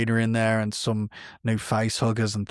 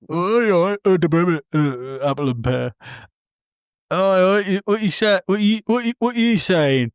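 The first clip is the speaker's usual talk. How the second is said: higher, louder mock English robot voice; English Chappie accent; 8 semitones higher, 4 dB louder than usual. Mocking Chappie to a friend in a hammed-up English accent. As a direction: ham up a posh-English Chappie. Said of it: "doing an English accent".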